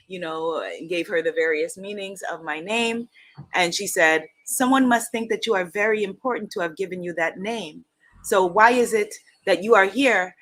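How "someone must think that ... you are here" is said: put-on deliberate, emphatic Igbo-accented lecture; bad Igbo teacher accent. Igbo teacher challenging a student over her name, badly imitated accent. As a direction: press the point in a roughly imitated Igbo accent. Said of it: "for doing a very bad accent".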